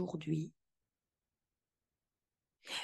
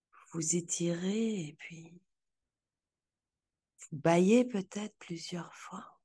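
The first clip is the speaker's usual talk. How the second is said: guided visualization. Acted.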